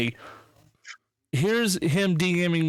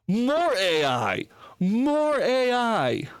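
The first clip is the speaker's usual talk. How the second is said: slow, stretched-out exclamation; drawn-out hype; 5 semitones higher, much slower than usual. Real, not acted. Host hyping the AI find to the guys, drawn-out excitement. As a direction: draw each word out, rising higher on the repeat. Hyped announcement of new AI find.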